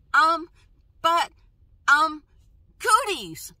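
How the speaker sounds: loud, high blurts with gaps between words; struggling to talk. Retold or imitated. Asking at the volunteer meeting despite speech block, blurted. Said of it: "And then I couldn't talk".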